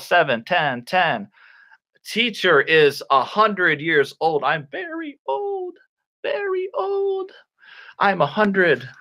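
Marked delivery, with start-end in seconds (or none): 4.7-7.3 very high, put-on feeble old voice; mock-ancient high wail; creaky old-person voice. Teacher jokes being very old.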